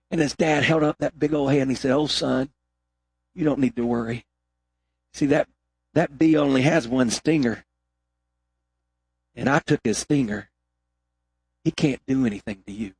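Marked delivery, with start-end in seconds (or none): 1.9-12.9 calm your son down gently; reassuring father. Acted.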